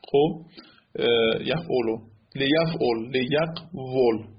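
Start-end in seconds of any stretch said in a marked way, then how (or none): 3.1-4.3 slow لیقول as le-yaq-vol; original form liyaqvul. Shown as a demonstration. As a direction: split لیقول slowly, v opening the last syllable.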